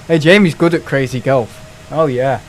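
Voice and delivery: usual speaking voice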